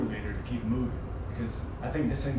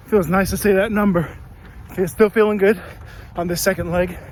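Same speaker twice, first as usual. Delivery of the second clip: talking mid-run, loud and breathless; speaking while jogging; while running; 3 semitones higher, 5 dB louder than usual. Real, not acted. Recording mid-run, outdoors.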